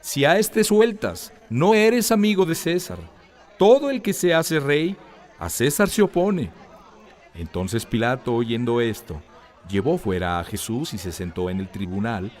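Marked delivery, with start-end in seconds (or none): 0.0-6.5 the mob pressures Pilate, yelling; crowd shouting. A narrator's reading. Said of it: "los judíos daban voces diciendo".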